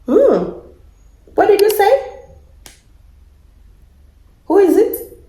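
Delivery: high, loud startled asking; startled questions. Real, not acted. Startled by a voice from the laptop, alarmed high questions. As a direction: ask in alarmed surprise.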